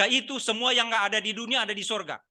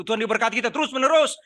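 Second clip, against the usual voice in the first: mock preacher's raised cry; louder and higher, imitating a shouting pastor; pendeta berteriak; 4 semitones higher, 6 dB louder than usual. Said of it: "Kalau pendetanya sudah teriak begitu". (meniru seruan pendeta yang lantang)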